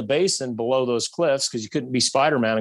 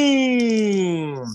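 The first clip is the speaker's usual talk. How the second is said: imitated gunshot; 9 semitones higher than usual. Retelling the third shot.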